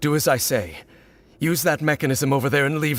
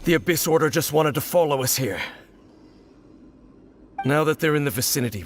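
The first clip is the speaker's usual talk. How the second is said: usual speaking voice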